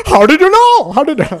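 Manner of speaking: high, loud amazed gasp; amazed tourist. Retold or imitated. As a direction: marvel like the dazzled tourist. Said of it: "then he's like".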